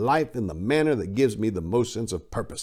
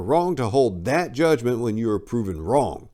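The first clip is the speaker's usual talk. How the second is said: plain reading voice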